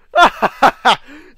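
loud, high burst of laughter. Real, not acted. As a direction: laugh out loud at a stuck foe. Taunting stuck zombie.

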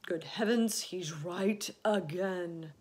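mildly astonished outburst; amazed cry. Acted. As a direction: exclaim in delighted realisation. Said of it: "cried the old green grasshopper".

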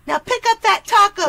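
high, loud command; angry order. Acted. Ordering someone to clean up a taco, loud anger. Quarrel over boyfriend and taco.

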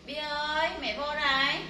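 loud, sustained call. Real, not acted. Mother looking for her daughter Bi, calling out.

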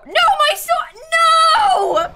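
high, loud cry of loss; anguished shout. Real, not acted. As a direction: shout in despair as it slips away. Dying, losing sword to dragon.

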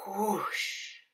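a rushing whoosh; the sun bursts out of the box; whoosh sound. A narrator's reading. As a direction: breathe it out like a sudden rush of wind. Box opened, sun flies out.

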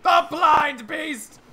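slow, high, loud proclamation; raving prophet. Acted. Prophet correcting the adventurer's mishearing, raving. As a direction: cry it out as a dire warning. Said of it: "you know, raving".

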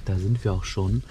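ordinary talk

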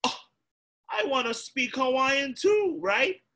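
put-on high, pleading little kid voice; whiny child. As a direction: beg like a jealous seven-year-old. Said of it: "And I was like".

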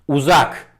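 Uzak called high and loud as if far away. Shown as a demonstration. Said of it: "Sesi de kendimden biraz uzaklaştırıyorum".

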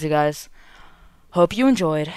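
ordinary talk

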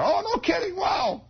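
put-on high, giggling boy voice, oohing and wowing; high laughing kid exclaiming; giggly excited kid. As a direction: gush like a kid hearing a secret. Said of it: "I'm laughing about it".

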